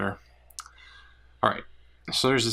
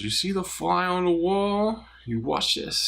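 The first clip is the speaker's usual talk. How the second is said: much higher, put-on master's voice; samurai master; 10 semitones higher than usual. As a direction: act the boastful champion. Joke, voicing the first-place samurai.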